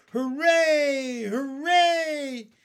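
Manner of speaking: loud, high-pitched cheering; jubilant cry. A narrator's reading. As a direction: cheer at full voice for the whole crew. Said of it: "they cried".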